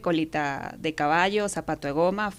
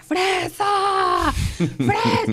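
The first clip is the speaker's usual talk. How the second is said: high-pitched street holler, drawn out; imitated yelling; 14 semitones higher, 4 dB louder, much slower than usual. Prisoners' women spot her on the avenue, imitated high yelling. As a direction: mimic women hollering a nickname from afar. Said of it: "empezaron a gritar".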